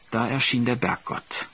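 ordinary delivery, nothing marked

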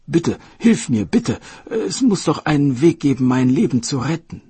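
a sick dragon king clinging to hope; pleading king. Acted. Sick king begs for help.

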